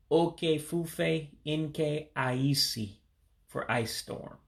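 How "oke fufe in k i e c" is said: slow, careful "oke fufe in K I E C"; slowly. Shown as a demonstration. Teacher repeats the Igbo phrase slowly for learners. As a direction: spell the Igbo out slowly, word by word. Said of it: "we'll say that slowly".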